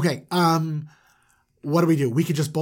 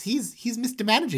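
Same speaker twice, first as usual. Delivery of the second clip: high, put-on character voice; Mister Manager's true voice; 8 semitones higher than usual. Shown as a demonstration. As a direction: lift the voice high for the real manager. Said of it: "his voice sounds like this".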